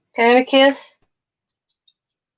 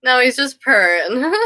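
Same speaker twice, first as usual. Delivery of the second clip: ordinary talk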